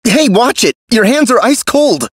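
high-pitched indignant yelp; startled protest. Acted. Game character recoiling from someone's icy hands, startled protest. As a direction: object sharply, pitched well up.

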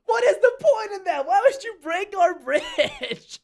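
high, drawn-out whining complaint; a hammed-up wail over the broken bridge; mock whiny lament. Gamer whines at the enemy team, slow and high. Enemies break their bridge.

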